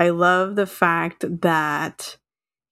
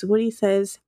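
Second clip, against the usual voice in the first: ordinary talk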